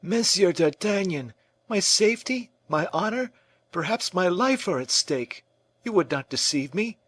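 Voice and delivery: high, pleading and uneasy; fearful plea. A narrator's reading. Fouquet begging d'Artagnan for the truth, fearful high plea. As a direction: beg him not to lie.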